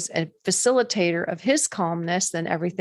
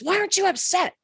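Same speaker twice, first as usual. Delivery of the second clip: surprised; 12 semitones higher than usual. Retold or imitated. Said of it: "And he goes".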